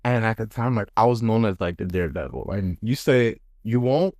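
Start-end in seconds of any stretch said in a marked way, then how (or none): none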